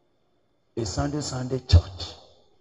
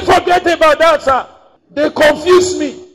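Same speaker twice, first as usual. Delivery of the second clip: very high, loud anguished cry; distressed outburst; 13 semitones higher, 10 dB louder than usual. Retold or imitated. Confused convert pleading with his old pastor, high loud distressed cry. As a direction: blurt it out in distress.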